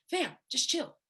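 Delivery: hint of a laid-back friend calming her down; easygoing mentor calming a crying student; Baker fashion. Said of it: "in Baker fashion".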